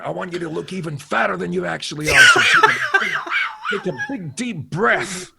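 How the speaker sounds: high, loud director giving instructions; John Landis. Retold or imitated. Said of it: "John went".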